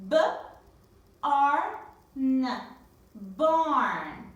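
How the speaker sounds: B-A-R-N spelled loud, then Barn said loud; spelling then word. Shown as a demonstration. Teacher to class, spelling then blending loudly. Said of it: "look at me here".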